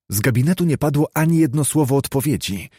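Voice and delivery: ordinary delivery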